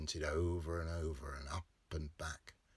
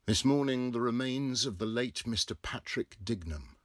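loud raised announcement; formal notice; 6 semitones higher, 4 dB louder than usual. A narrator's reading. Narrator voices the typeset notice aloud. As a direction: proclaim it like a headline.

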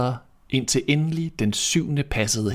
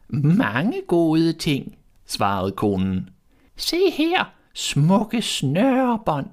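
very high, very slow crone voice; old peddler woman; 7 semitones higher, much slower than usual. A narrator's reading. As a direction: coax her like a sly old hag, drawling. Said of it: "som en gammel sælgekone".

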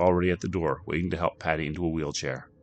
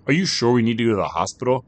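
raised voice; 5 semitones higher, 7 dB louder, faster than usual. Acted. Husband doubts over crying baby.

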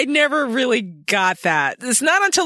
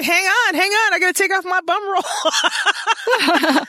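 mock urgent Diana; 7 semitones higher, slower than usual.

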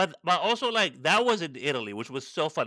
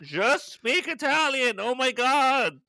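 high-pitched, drawn-out exasperated plea; slow, high, fed-up groaning complaint; exasperated outburst; 10 semitones higher, 3 dB louder, slower than usual. Acted. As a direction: stretch the words out in mock exasperation. Acted exasperated outburst at TV show.

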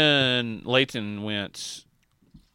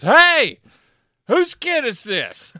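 loud, high mock holler of a grouchy ride worker; imitated gruff yell; 11 semitones higher, 11 dB louder, much slower than usual. Old ride worker yells at parents, imitated holler. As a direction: yell it out like a cranky old attendant. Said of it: "All of a sudden, he goes".

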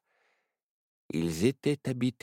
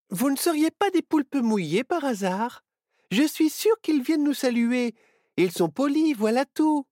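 put-on high child's voice; 5 dB louder than usual. A narrator's reading. Nao voiced.

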